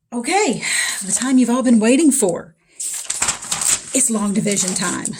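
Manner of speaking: loud, slow game-show build-up; booming mock fanfare for long division; hyped announcement. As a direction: announce it like the main event.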